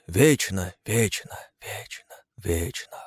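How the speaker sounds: repeated word dying away to a whisper; fading echo. A narrator's reading. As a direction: echo it, fading each time. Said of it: "Эхо отозвалось".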